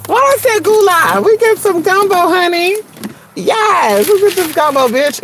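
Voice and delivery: high-pitched, tickled outburst; amused exclaiming. Real, not acted. Laughing off saying goula for gumbo, high excited voice. Amused at own slip of word.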